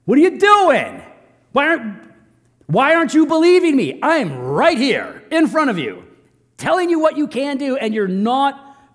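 loud, high mock scolding; pretend yelling. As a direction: bark it like an exasperated parent. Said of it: "He's not yelling at him".

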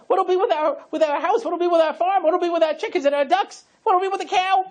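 high-pitched, fretting wife voice; alarmed wife. Acted. Wife worries to husband about farm and cow, alarmed.

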